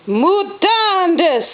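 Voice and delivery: high, very slow mock boom. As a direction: stretch out the spell like a sorcerer. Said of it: "boomed the sorcerer".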